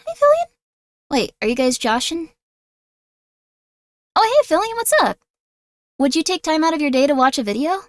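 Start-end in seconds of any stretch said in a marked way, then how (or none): 0.1-0.5 squeaky excited hello; high pitched excited greeting. Real, not acted. Greeting a raider. 4.1-5.2 high bright hello; excited greeting. Real, not acted. Greeting a raider.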